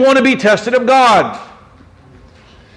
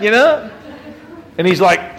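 normal talking voice

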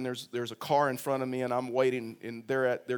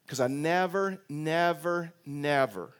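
each "never" pressed hard, then fading; repeated never; 2 semitones higher, slower than usual. Retold or imitated. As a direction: hammer "never" three times, dropping on the last. Hurt churchgoer's repeated vow.

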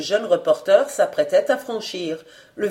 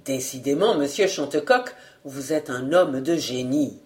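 satisfied; 6 semitones lower, 3 dB quieter than usual. Acted. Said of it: "d'un air satisfait et même joyeux".

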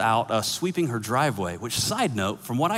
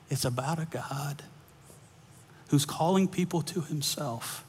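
earnest preaching; 5 semitones higher, slower than usual. Acted. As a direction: lean into the point and slow down. Preaching climax, slow.

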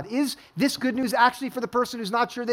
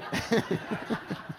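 breathy laugh; 7 dB quieter than usual. Real, not acted. Laughs at his own story.

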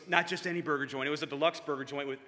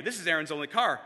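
plain reading voice

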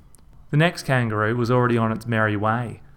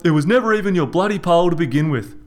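higher, louder, fast yell; 7 semitones higher, 6 dB louder, faster than usual. A narrator's reading. Reg yelling about the fishing pole. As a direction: rattle it off in a furious yell. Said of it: "Reg yelled".

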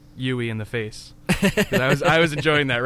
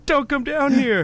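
high, slow, pleading yell, imitated; mock shout; 13 semitones higher, 6 dB louder, slower than usual. Friend acting the hero shouting down to the girl, high mock yell. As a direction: yell a frantic warning from below. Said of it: "And he's like".

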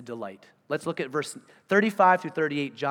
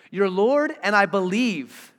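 loud, higher, slowing on "I believe"; emphatic confession; 4 semitones higher, 7 dB louder, slower than usual. Acted. Preacher repeats the man's confession to Jesus, emphatic. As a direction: proclaim it with rising force.

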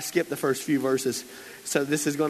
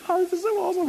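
put-on thrilled kid; much faster than usual. Kid thrilled hearing sermon.

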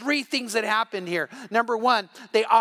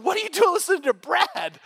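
incredulous friend; 10 semitones higher, 4 dB louder than usual. Retold or imitated. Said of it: "He's going".

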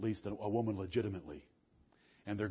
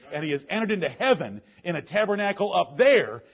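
loud, high, hammering preacher's cadence; fiery preaching; 5 semitones higher, 11 dB louder than usual. Acted. Preacher exhorting his church, loud and driving. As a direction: preach it to the congregation full force.

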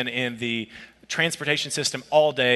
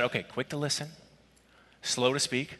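quiet self-talk; 8 dB quieter than usual. Retold or imitated. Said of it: "I quietly held my spirit back".

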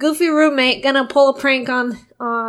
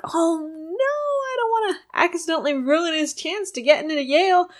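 panicky roommate; 6 semitones higher than usual. Retold or imitated. Roommate panics about Yale call.

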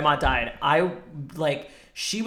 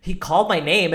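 ordinary delivery

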